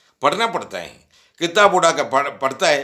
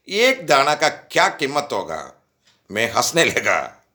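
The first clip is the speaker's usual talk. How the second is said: normal talking voice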